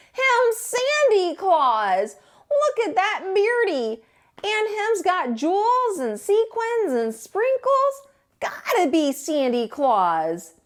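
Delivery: a goofy hunter thrilled to meet Santa; silly hunter voice. Acted. Hunters grinning at Tacky.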